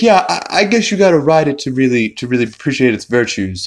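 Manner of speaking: high, fast stammering; hurried, flustered retold reply; mock nervous concession. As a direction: imitate a kid rationalizing anxiously. Stewie nervously excuses the theft.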